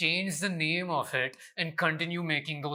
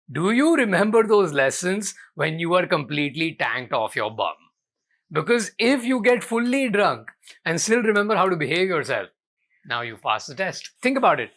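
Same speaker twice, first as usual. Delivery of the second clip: loud rant; 2 semitones higher, 8 dB louder than usual. Real, not acted. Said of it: "I'm clearly angry".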